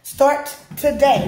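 slow firm Start today; emphatic start today. Shown as a demonstration. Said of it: "is what I'm gonna say".

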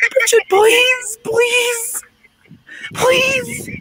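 very high, drawn-out wailing plea; desperate pleading. Real, not acted. Stranded caller begging a friend to return, desperate. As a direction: beg in a high panicked wail.

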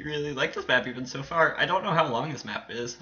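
ordinary talk, nothing marked

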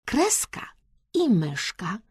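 usual speaking voice

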